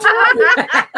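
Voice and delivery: loud, high, laughing "no"; squealed, amused protest; laughing denial. Retold or imitated. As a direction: deny it in comic alarm.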